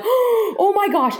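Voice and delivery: very high, drawn-out reenacted astonishment; starstruck amazement. Retelling their reaction to an idol calling, starstruck. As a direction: squeal it slowly, like a starstruck fan.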